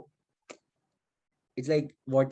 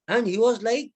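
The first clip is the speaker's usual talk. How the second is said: ordinary talk, nothing marked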